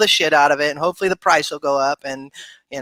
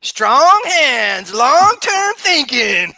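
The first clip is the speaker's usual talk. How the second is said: higher, louder, very slow motto delivery; slogan proclaimer; 7 semitones higher, 5 dB louder, much slower than usual. Acted. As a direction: boom it out like a hammed-up crypto slogan. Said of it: "You sound like someone I know".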